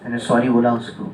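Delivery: ordinary delivery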